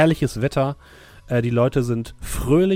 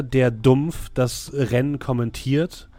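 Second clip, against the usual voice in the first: usual speaking voice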